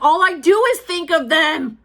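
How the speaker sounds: rising frustration; frustrated. Real, not acted. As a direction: vent the frustration. Venting frustration.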